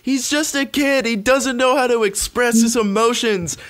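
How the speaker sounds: pleading a kid's innocence, mock; mock mother voice. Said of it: "she plays it off like".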